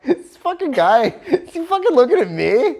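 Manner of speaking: high, loud, laughing outrage; half-laughing disbelief at the driver; laughing, annoyed at a driver far away; laughing annoyance. Retold or imitated. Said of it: "And I'm like".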